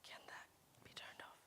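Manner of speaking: hushed off-mic whisper; a quick whispered aside to the tech crew. Real, not acted. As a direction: whisper the request away from the audience. Asking staff to turn off screen.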